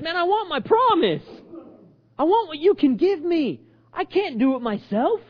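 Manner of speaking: slow, high-pitched demand; insistent plea. Acted. Blind man claiming his promise, insistent. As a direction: press the request emphatically.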